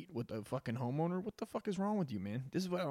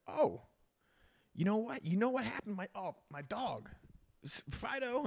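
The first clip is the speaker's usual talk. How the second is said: higher, hammed-up excuse; a car thief inventing an excuse, higher; fake excuse; 9 semitones higher than usual. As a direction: stammer out a made-up story about the dog. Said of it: "I'd be like".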